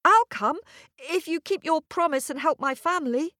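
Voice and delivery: put-on high eager girl's voice; breathless eager young daughter. A narrator's reading. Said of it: "came a voice from an upstairs window".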